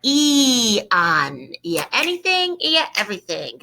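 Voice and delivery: ian said with the i stressed; ia, the stress landing on the i; accent on the I. Shown as a demonstration. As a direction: sing out ia as a little chant. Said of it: "It's an accent on the I".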